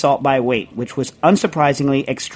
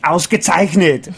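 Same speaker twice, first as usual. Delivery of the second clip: slow Austrian Ausgezeichnet in a heavy Arnold voice, louder; heavy Austrian accent; Schwarzenegger accent; 4 semitones higher, 4 dB louder, slower than usual. Shown as a demonstration. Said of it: "Arnold Schwarzenegger".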